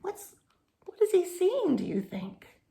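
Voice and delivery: plain reading voice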